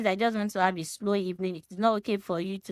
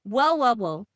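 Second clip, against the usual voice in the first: mocking shrug; 2 semitones higher, 4 dB louder, slower than usual. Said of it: "be like".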